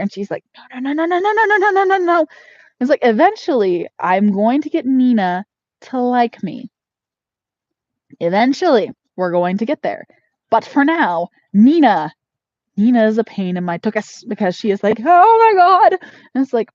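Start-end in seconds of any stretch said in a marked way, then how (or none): 0.7-2.3 high squeaky refusing bunny voice; voicing rabbit. Owner voicing doe Nina refusing, high squeaky voice. 15.0-16.0 high louder alarmed bunny voice; a squeaky startled gasp of a line; voicing rabbit. Owner voicing doe Nina's alarm, high and louder.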